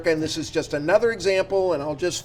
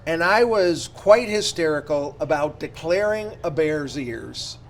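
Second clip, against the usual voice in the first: usual speaking voice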